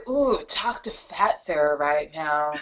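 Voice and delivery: a hint of seductive whisper; mock sexy voice. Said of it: "I'm always like".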